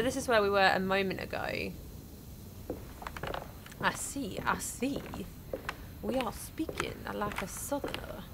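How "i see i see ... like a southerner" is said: whispered line in a vague Southern accent; soft, tentative Southern accent; southern accent attempt. Shown as a demonstration. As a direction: try a Southern drawl, half under the breath. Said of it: "I don't know what that accent is".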